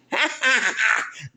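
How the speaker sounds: high delighted laugh; short burst of real laughter. Real, not acted. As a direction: laugh out with pride. Laughs after praising Granny.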